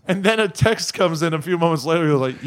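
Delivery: ordinary talk, nothing marked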